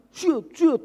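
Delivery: high, loud car swoosh sound; imitated swoosh. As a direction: mimic a car scraping past, high. Car sideswipes them. (tiruan suara mobil menyerempet, tinggi)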